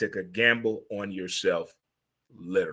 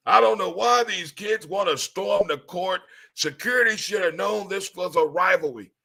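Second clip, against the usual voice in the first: higher put-on voice mocking officials; 6 semitones higher than usual. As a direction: parody the clueless official in a higher voice.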